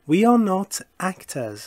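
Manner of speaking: very slow, carefully spaced English; deliberate classroom model reading; slow model sentence. Shown as a demonstration. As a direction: read it out slowly for learners to copy. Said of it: "teacher models example sentence".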